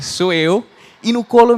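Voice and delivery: normal talking voice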